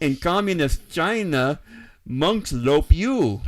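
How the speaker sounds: slow, loud joke delivery; mock Russian reversal. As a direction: drag it out as a meme punchline. Joke punchline voice.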